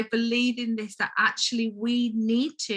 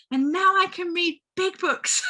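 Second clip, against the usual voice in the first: choked up, voice rising high; fighting back happy tears; teary; 8 semitones higher than usual. Real, not acted. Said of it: "oh, I'm feeling teary now".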